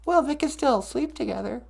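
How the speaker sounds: high little character voice; objecting sidekick character. Acted. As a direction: protest in a squeaky puppet voice.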